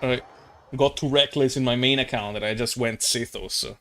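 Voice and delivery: ordinary talk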